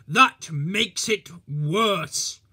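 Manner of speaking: loud, slow, stern rebuff; stern retort. Acted. Policeman rebuffs the driver, loud and stern. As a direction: shut the driver down firmly.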